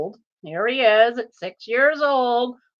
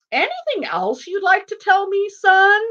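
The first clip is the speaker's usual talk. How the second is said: lightly voiced cop, raised pitch; questioning officer; 8 semitones higher than usual. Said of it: "he goes".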